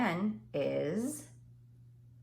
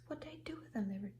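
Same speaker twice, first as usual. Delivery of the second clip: muttering to herself; 4 semitones higher, 6 dB quieter, much faster than usual. Real, not acted. Teacher searching for her cards.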